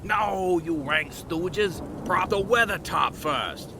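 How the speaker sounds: loud, high, slow furious bellow at the stooges; shouting down a bunch of idiots; angry yell. Acted. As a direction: chew them out at full volume. Yelling at stooges.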